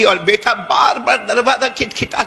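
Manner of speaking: ordinary talk, nothing marked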